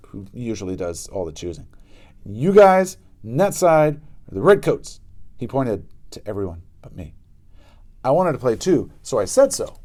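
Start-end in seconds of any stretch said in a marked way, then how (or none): 2.2-5.0 put-on loud, high, slow bossy kid giving orders; bossy shout. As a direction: boss the gang around at full voice. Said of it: "said Pinky".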